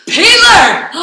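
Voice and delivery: thrilled shout of a name; excited call. Real, not acted. Greets mentor joining online.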